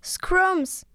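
high-pitched chanted call. A narrator's reading. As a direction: call out the magic word on cue. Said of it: "Und wir alle riefen".

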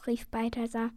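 ordinary delivery

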